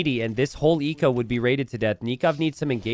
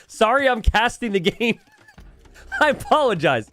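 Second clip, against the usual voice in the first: laughing apology; 9 semitones higher than usual. Real, not acted. Apologizing for missing donation alerts.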